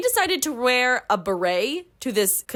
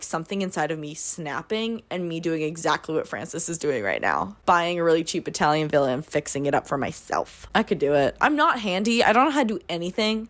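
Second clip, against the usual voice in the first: ordinary talk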